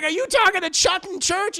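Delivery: loud, high accusing question; outraged confrontation. Retold or imitated. Wife confronts Rufus about Chuck, outraged.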